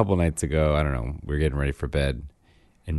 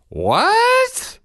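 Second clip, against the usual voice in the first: high, loud squeak of surprise; dad mimicking his daughter's loud, squeaky disbelief; astonished child; 8 dB louder than usual. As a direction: squeal it like a shocked little girl. Daughter's shocked reply.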